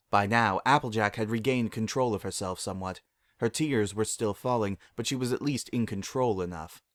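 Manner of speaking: ordinary delivery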